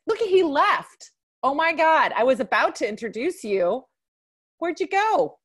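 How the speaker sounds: high, loud startled calling out; surprised. Real, not acted. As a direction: call after him in surprise. Host finds guest has left.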